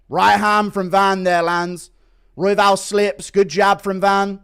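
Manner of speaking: excited fight commentary; live play-by-play. Real, not acted. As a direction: call the action live.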